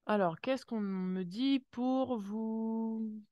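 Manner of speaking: ordinary talk, nothing marked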